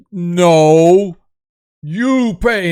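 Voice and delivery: loud, stubborn giant voice; insistent giant. Acted. Giant refusing to leave unpaid, loud and insistent.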